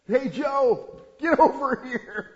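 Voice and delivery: high, loud calling out to a friend; panicked shout. Acted. Panicked shepherd shouting across the hillside to Joe.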